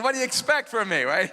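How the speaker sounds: giggly self-mocking aside; laughing. Real, not acted. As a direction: tease yourself while laughing.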